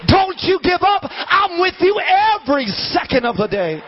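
high, impassioned sermon delivery; full-force preaching. Real, not acted. Preacher urging the congregation not to quit, fervent preaching.

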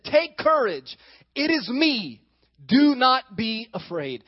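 Jesus reassuring. A narrator's reading. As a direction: call out calm across the storm. Jesus calming the frightened disciples.

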